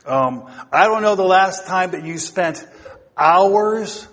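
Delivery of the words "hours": "hours" set apart, louder and higher; stress on hours. Real, not acted. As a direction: punch the word "hours" and hold it. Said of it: "I stress the word hours".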